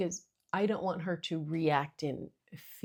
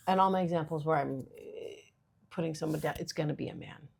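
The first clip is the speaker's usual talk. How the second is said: ordinary talk, nothing marked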